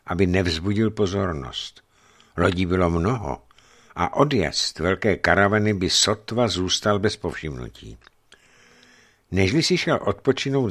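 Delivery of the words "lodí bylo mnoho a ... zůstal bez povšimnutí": ordinary delivery, nothing marked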